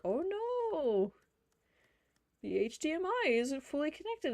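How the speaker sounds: high-pitched imitation of a whining screen; put-on high "oh no" voice; mock fussy computer. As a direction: play a machine in mock dismay. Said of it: "my computer screen 'cause it keeps acting".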